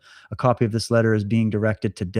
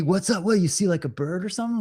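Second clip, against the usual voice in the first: breezy and carefree, a bit higher; happy-go-lucky; 6 semitones higher than usual. Retold or imitated. Friend shrugs off what his buddy sees, breezy and carefree. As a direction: toss it off like nothing's wrong. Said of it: "happy-go-lucky".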